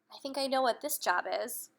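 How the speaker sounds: ordinary delivery, nothing marked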